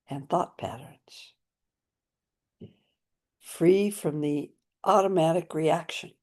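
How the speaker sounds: solemn prayer recitation spoken to the group; aspiration prayer. Acted. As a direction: intone each phrase like a vow.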